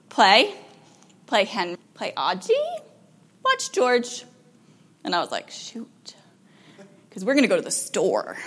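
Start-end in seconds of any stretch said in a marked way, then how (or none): 0.1-4.2 a hint of a toddler listing wishes; lightly imitated small child. Child telling parent plans for today, light toddler imitation. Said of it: "She's like". 5.5-8.4 whispered mock-dismayed aside. Parent realizing it's store day, whispered dismay.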